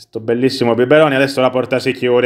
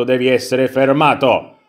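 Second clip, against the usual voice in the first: ordinary talk, nothing marked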